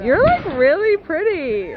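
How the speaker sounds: put-on high, slow, drawn-out Regina George accent with "like" stretched; full Mean Girls Regina George impression, "like" rising. Redoing the phone case line for her friend, full Regina George accent. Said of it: "you have to say it in a Regina George accent".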